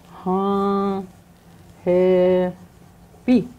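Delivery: "Ha happy" split into long, separate syllables; drawn-out teaching "Ha", gap, then "happy"; syllable breakdown. Shown as a demonstration. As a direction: sound out "Ha happy" slowly, pausing after "Ha".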